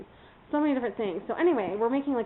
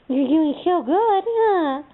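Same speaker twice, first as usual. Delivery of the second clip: higher, louder, slow praise in a pet voice; praising puppy talk; 8 semitones higher, 6 dB louder, much slower than usual. Real, not acted. Owner praising her puppy, high slow pet talk.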